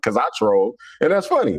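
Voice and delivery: ordinary delivery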